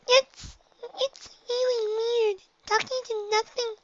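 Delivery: high little filly voice; small child pony, very high; high squeaky young filly. A narrator's reading. Narrator voices Dinky.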